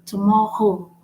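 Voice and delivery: "tomorrow" with a guttural French R in the middle. Shown as a demonstration. Teacher showing how they stress it, "tomorrow" with guttural R. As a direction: rasp the R in "tomorrow" at the throat. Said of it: "They stress on it".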